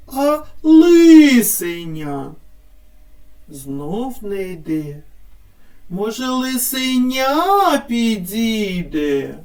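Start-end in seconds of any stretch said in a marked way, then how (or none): 0.6-2.3 "лисеня" slowly, with ли loud and drawn out; wrong first-syllable stress. Shown as a demonstration. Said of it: "на який склад падає наголос". 6.3-7.8 "лисеня" with the final ня stressed and lengthened; stress on third syllable. Shown as a demonstration. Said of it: "на третій".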